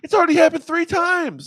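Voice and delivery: high, loud accusing partner; angry partner. Acted. Acted partner quarrel on phone.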